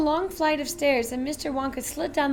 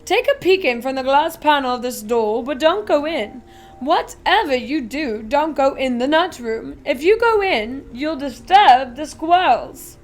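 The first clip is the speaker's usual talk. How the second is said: louder, showman factory owner voice; a giddy chocolatier showing off; Willy Wonka, grand and eager; eccentric Wonka; 8 dB louder than usual. Acted. Wonka voice warning.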